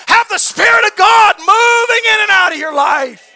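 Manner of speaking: loud, high, fervent preaching; preaching at full force. Acted. Preacher urging his friends to let the Spirit move, fervent shout. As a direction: drive the message home to the pews.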